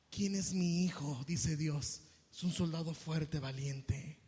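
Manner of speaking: low, slow, solemn proclamation; deep, weighty declaration; voice of God. Acted. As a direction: declare proudly as God over a son. Voicing God proud of his son.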